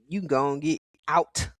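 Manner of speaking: ordinary delivery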